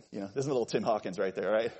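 ordinary talk, nothing marked

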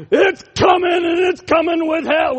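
shouted high movie-villain growl; channeling Tombstone. Retold or imitated. Preacher channeling Kurt Russell in Tombstone, shouting. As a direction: deliver it like a western avenger. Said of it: "channeling right there is, uh, Tombstone".

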